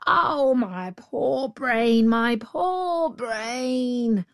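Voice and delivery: slow, high drawn-out lament; wailing. A narrator's reading. Jackal feigning confusion to the tiger, wailing. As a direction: cry it out in hammed-up distress. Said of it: "cried the jackal".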